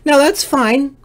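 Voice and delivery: ordinary talk, nothing marked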